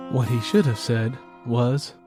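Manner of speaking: usual speaking voice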